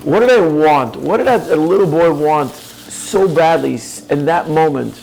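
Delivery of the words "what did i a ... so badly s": ordinary talk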